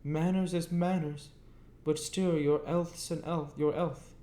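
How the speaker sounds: high, earnest country voice, still worried; a plain blacksmith fretting over the boy's health; Joe, earnest. A narrator's reading. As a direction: insist kindly, in a high voice. Joe's voice continues.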